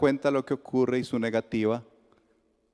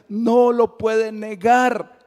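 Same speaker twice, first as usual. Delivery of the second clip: slow, higher and louder no lo puede negar; forceful; 8 semitones higher, 6 dB louder, much slower than usual. Acted. Preacher to the congregation, slow and ringing. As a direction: proclaim it with full conviction. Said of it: "preached refrain, strong take".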